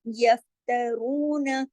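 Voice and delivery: यफ्तरून slow, रू heavy and long; tajweed recitation. Shown as a demonstration. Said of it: "रू मोटा भी और दो हरकत खींचेगा". (यफ्तरून में रू मोटा और लंबा)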